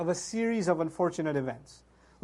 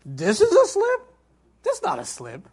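mock the professor's shock, squeaking up; astonished professor can't believe the word; incredulous disbelief; 4 dB louder than usual. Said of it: "So the professor says".

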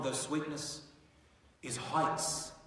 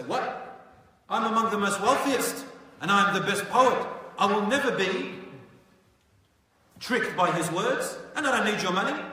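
loud, high and proud, brushing off the offer; proud defiant boast; 6 semitones higher, 9 dB louder than usual. Acted. Al-Walid proudly shouting down Abu Jahl's offer. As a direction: boast like the richest man in town.

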